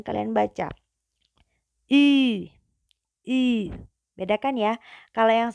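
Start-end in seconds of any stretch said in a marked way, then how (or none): 1.9-3.7 I with pouted lips, first one louder; rounded vowel. Shown as a demonstration. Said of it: "untuk O umlaut ini juga".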